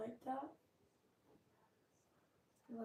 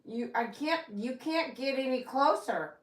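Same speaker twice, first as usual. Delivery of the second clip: playful exclaim; 4 semitones higher, 8 dB louder than usual. Real, not acted. Dog squeezing closer.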